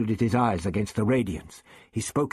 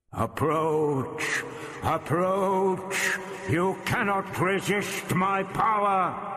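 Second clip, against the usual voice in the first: drawn-out, echoing alien command; booming order; 7 semitones higher, much slower than usual. A narrator's reading. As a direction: chant it as an irresistible monster, pitch raised. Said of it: "the voice boomed the order".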